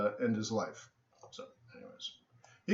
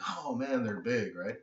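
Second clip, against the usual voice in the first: quiet awe; 3 semitones lower, 9 dB quieter than usual. Retold or imitated. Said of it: "and I'm like".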